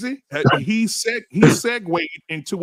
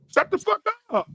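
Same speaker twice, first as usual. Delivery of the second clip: mock high-pitched snap; 13 semitones higher than usual. Acted snap at a mouthy woman.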